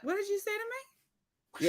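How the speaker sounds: high, soft, dangerous question; mock stern mom, suddenly high and quiet; incredulous challenge. Acting stern mom's challenge.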